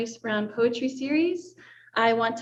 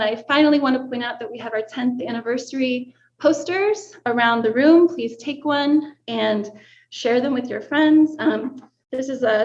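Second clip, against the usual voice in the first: ordinary talk, nothing marked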